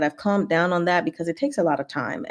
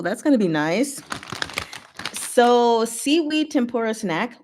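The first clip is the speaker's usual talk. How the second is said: usual speaking voice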